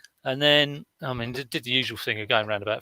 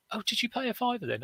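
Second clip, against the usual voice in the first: church woman's question; 11 semitones higher, 4 dB quieter, faster than usual. Retold or imitated. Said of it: "this woman s-, said".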